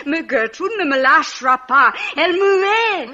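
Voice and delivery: loud, high indignant cry; agitated protest. Acted. Drunk woman loudly protesting Gertrude hates her. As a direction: protest loudly, raising your voice.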